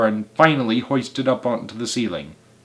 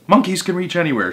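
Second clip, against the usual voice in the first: quick high exclamation; shouting; 7 semitones higher, 3 dB louder, faster than usual. A narrator's reading. Mugglewump answers the doubting helpers, shouting. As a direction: fire it back in a raised voice. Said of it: "shouted Mugglewump".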